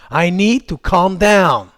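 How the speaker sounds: drawn-out, clearly voiced self-instruction; slow, deliberate phrase. Shown as a demonstration. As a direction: say it slowly and firmly. English calming phrase, full-voiced take. (phrase lente, posée et appuyée)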